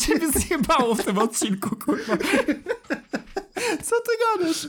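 squeaky-high laughing disbelief; helpless laughter, voice shooting way up; cracking up. Real, not acted. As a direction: barely get it out through real laughter. Cracking up at co-host's bluff.

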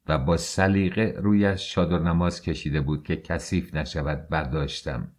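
ordinary delivery

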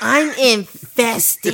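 loud, very slow, drawn-out complaint; mock overreaction. Exterminator mocking a customer's bug call, loud drawn-out whine. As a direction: stretch it out like a hammed-up panicking customer.

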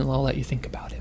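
soft unvoiced whisper, very calm. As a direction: whisper it gently to the child. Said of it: "I was just so calm as I could be".

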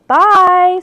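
big, high, loud farewell call. A narrator's reading. Annie calls after the departing Triceratops, loudly. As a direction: call it after someone leaving.

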